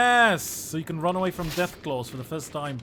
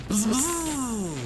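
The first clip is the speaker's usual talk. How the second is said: high buzzing bee noise, rising on the second; bee battle cry; 8 semitones higher, 3 dB louder than usual. Retold or imitated. Streamer doing the House Beesbury battle cry, high bee buzz. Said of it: "Battle cry of House Beesbury".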